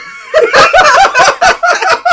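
shouted laugh. Real, not acted. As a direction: break into a yelp of laughter. Laughs mid-poem.

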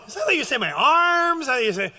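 high, loud, laughing complaint; laughing protest. Retold or imitated. Husband laughing at his wife, loud high protest.

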